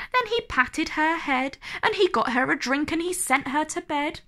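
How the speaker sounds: ordinary delivery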